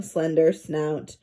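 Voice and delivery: plain reading voice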